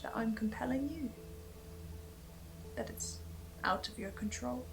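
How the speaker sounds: a hushed unvoiced whisper. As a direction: breathe it out with no voice.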